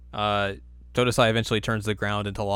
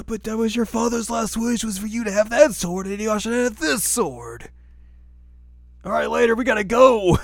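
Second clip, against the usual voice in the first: much higher, put-on old man voice; a squeaky doddering old smith shooing them off; high cartoon old man voice; old swordsmith voice; 11 semitones higher than usual. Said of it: "But Totosai's like".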